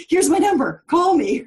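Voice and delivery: playful, punchy acted invitation; eager call-out. Acts out an eager chat message.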